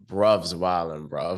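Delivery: mock London street accent; a hint of London slang voice; British accent. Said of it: "Sorry, British people".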